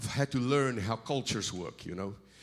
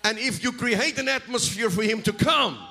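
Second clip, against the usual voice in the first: higher, louder and building to the end; full-force preaching; 10 semitones higher, 6 dB louder than usual. Real, not acted. Preacher rallies the congregation about atmosphere in full-force preaching. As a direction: preach it up to a roar.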